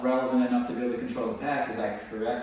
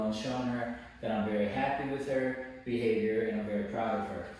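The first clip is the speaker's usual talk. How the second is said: ordinary talk, nothing marked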